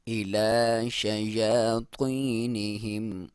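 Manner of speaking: slow, drawn-out tajweed chant; slow Quran recitation. Shown as a demonstration. Teacher reciting the verse for students, slow and drawn out. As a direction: recite it slowly, stretching each vowel. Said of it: "यहाँ पे फिर मद्दे जायज़ है".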